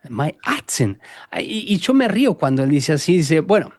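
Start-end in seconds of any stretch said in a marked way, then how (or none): 0.1-1.0 a soft, breathy aside; whispered aside. Real, not acted. Speaker whispers his amusement at the praise for his accent.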